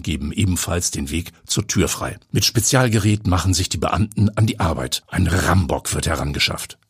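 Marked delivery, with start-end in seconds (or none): none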